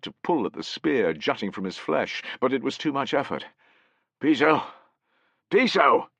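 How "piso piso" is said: loud, higher call of a name. A narrator's reading. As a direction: call to the fallen man. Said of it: "he heard a voice calling".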